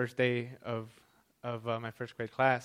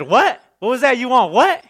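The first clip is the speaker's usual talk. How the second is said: loud impatient demand; 11 semitones higher, 14 dB louder than usual. Retold or imitated. Server's impatient retort quoted.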